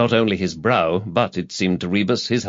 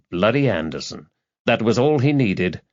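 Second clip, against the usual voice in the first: ordinary delivery, nothing marked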